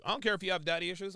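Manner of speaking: usual speaking voice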